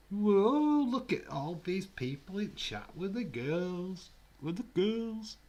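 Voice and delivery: hammed-up high-pitched teasing; mocking high voice. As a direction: squeal it at the chat in mock awe.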